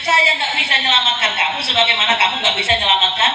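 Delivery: high-pitched, emphatic refusal; acted devil disowning his followers, voice climbing high; Iblis. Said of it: "Katanya Iblis".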